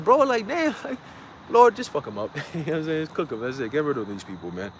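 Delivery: ordinary delivery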